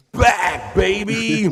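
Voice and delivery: big excited reveal; excited shout. Real, not acted. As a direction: announce it like a hype man. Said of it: "hyping news story return".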